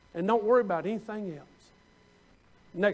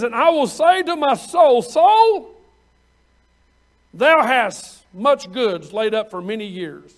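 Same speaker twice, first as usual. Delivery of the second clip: smug rich man; 6 dB louder, slower than usual. Retold or imitated. Preacher voicing the rich man talking to his soul, gloating and loud. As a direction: crow over your full barns. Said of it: "he says".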